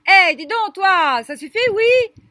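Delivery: high, very loud scolding shout; cri très fort. Acted. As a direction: yell at someone in the street to stop. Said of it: "un cri très très fort". (crié très fort, voix aiguë)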